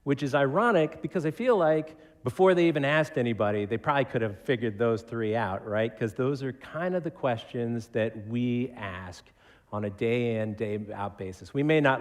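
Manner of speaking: normal talking voice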